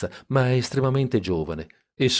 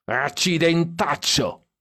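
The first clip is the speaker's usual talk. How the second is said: pitched higher, slow drawn-out cry; drunken exclamation; 8 semitones higher, slower than usual. A narrator's reading. Drunk Stan crying out from the back, high and slow. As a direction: crow it out tipsily. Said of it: "urlò Stan dietro".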